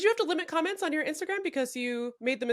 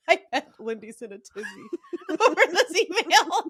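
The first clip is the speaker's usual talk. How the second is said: words squeezed through laughter, rising louder; laughing retelling; 7 semitones higher, 6 dB louder than usual. Retold or imitated. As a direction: quote it while cracking up. Cracking up retelling own thought.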